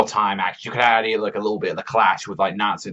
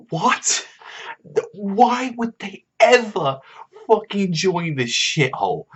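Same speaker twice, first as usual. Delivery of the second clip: high-pitched disbelief, drawn out; baffled, exasperated high rant; incredulous outburst; 10 semitones higher, slower than usual. Real, not acted. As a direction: protest it slowly, voice pitched way up. Incredulous rant about plot twist.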